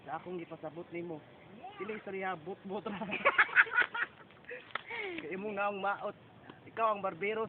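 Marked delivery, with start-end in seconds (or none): 3.0-4.2 short high-pitched laugh; burst of laughter. Real, not acted. Roaster after own jab, high burst of laughter.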